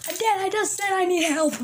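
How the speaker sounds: high-pitched appeal to Dad; pleading. Acted. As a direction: beg in a high voice. Son begs dad for help.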